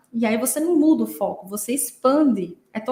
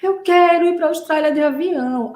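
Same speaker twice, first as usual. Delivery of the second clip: put-on loud, high child's plea; mock crying; 6 semitones higher, 5 dB louder than usual. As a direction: wail it in imitation of a tearful child. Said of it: "Ela começou a chorar de novo".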